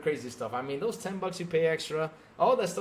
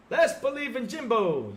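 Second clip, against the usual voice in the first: mock fan cheer; 6 semitones higher, 4 dB louder than usual. Mocking fans' faith.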